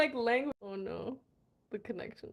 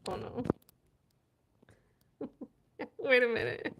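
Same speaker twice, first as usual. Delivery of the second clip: ordinary talk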